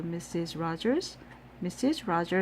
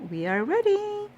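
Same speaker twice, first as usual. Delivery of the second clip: high, louder call; 13 semitones higher, 4 dB louder than usual. A narrator's reading. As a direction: call them to get ready. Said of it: "called Amelia Bedelia".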